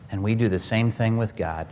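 ordinary talk, nothing marked

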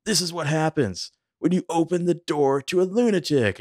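high-pitched acted-out tirade; full of fury. Podcaster voicing a furious father scolding his wife and daughter, full of fury. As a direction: rail at them in imitated fury. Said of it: "Peter is full of fury".

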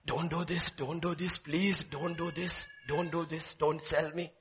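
soft, understated begging, a hint of a wail; mild pleading wail. Boy Joseph softly begging his brothers in memory. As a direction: plead quietly, as a remembered cry. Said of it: "how he wailed".

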